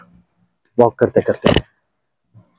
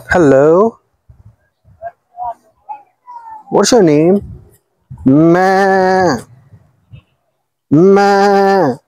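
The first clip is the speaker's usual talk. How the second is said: playful high voice; 5 semitones higher, slower than usual. Real, not acted. Talking to animals by the water.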